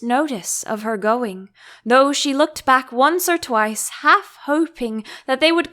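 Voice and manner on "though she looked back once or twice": plain reading voice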